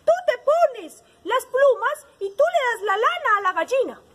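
high, loud parrot voice; advising parrot. A narrator's reading. Parrot telling sheep and hen to swap, emphatic. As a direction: propose it like a bossy storybook parrot. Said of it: "Entonces el lorito le dijo".

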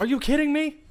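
high, loud frustrated rant; exasperated yell. Real, not acted. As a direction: rant fast in disbelief. Frustrated outburst.

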